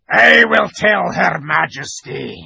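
slow, loud wolfish growl; high, shouted beast voice. A narrator's reading. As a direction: growl it slowly with menace. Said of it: "said in a growling voice".

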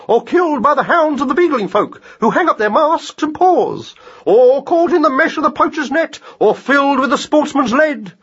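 high, rhythmic sing-song verse. Acted. Mad hare recites grim rhyme to mouse, sing-song. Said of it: "in a sing-song voice".

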